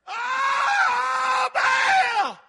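long, high, full-voiced wail; hammed-up howl to an idol. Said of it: "Get louder".

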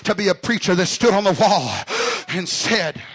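loud, building revival preaching; full-force preaching. Real, not acted. Preacher charging fellow preachers to stand on the wall, full-force preaching. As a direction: preach it hard, climbing to a shout.

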